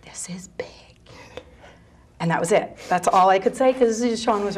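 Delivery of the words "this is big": barely voiced, secretive whisper; hushed retold aside, all breath; mouthed whisper. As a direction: mouth it silently to someone, almost no sound. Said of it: "I was able to mouth to Tim".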